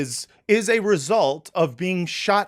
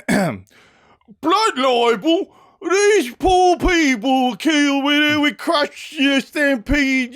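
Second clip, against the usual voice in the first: very high, loud, hammed-up British caricature; fake British accent; 10 semitones higher, 4 dB louder, slower than usual. Host reads propagandist's tweet, in a fake British accent. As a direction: squawk it out in a bad British accent. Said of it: "who enjoys my fake British accent".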